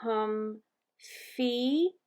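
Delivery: drawn-out syllables, rising at the end; hesitant learner. A narrator's reading. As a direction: try "Hum-fee" syllable by syllable, unsure. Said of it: "looked a little frightened, but she tried".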